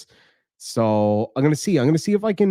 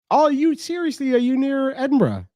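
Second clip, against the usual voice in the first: surprised; 13 semitones higher than usual. Real, not acted. Viewer lives near Edinburgh.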